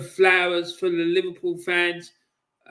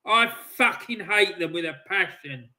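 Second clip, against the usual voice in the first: ordinary talk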